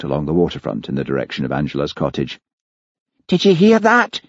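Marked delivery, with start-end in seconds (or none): 3.3-4.2 high, shrill accusing question; shrill, startling demand. Acted. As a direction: pipe up sharply in a very high voice. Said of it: "A shrill voice right behind him".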